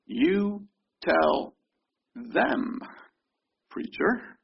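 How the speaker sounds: "You tell" drawn out, "them" lifted high, "preacher" whispered; slow stressed phrase. Shown as a demonstration. Pastor slowly spelling out "You tell them, preacher", ending in a whisper. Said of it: "Do you see what that means?".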